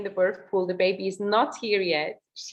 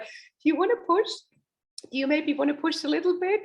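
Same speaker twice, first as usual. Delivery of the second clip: high, quick, tentative mock midwife; lightly voiced midwife nudging her to push; confused midwife; 7 semitones higher than usual. Imitating the confused midwife asking the mother to push, lightly voiced.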